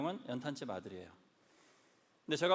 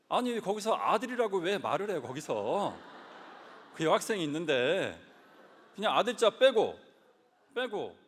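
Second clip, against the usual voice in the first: reliving the anger; 3 semitones higher, 6 dB louder than usual. Retold or imitated. Son recounting his teenage fury to the congregation, heated. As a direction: flare up at the memory of mother's words.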